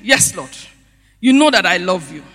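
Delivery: mild imitated enthusiasm; enthusiastic reply. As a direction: answer gladly with a chuckle. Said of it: "And enthusiastically".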